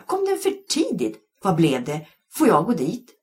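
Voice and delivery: put-on high, loud, eager child questions; excited shriek. A narrator's reading. Janna firing questions at the teacher, excited. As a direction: pile on the questions. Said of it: "skrek Janna".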